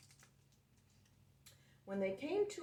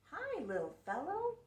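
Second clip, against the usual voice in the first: friendly school bus; 9 semitones higher than usual. Acted. School bus greets the truck.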